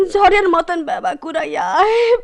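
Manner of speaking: high, half-sobbing wild laugh, slowing at the end; laughing and crying. Acted. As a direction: come apart between laughter and tears. Woman breaks down in storm. (ঝড়ের মাঝে কান্না-হাসি মেশানো ভাঙা গলা)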